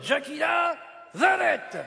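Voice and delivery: high, drawn-out showbiz shout; star's proclamation. Acted. Puppet declaring himself the new star, shouted announcer-style. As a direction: announce yourself with long stretched syllables.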